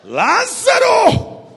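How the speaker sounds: calling Lazarus out of the tomb, huge drawn shout; commanding shout. Pretend. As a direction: summon him from the grave. Acted call into the tomb.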